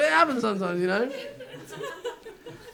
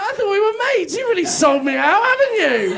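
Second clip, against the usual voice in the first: put-on high, loud baby-talk telling off; betrayed by the sniffer dog, in sing-song dog voice; dog voice scolding; 9 semitones higher, 7 dB louder than usual. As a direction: sweetly scold the dog as a traitor. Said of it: "So I was still doing the voice".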